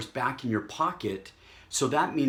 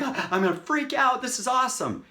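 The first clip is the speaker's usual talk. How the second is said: high-pitched gushing; hammed-up squeal of excitement; over-excited; 12 semitones higher, 3 dB louder than usual. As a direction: act out someone freaking out with joy. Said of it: "they get so excited, like".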